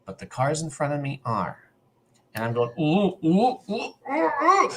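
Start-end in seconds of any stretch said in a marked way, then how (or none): 2.8-4.7 rising, high-pitched panicked oohs; acted panic. Driver whose brakes are failing, panicked oohs. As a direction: act out mounting panic in little high oohs. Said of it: "you can feel that panic".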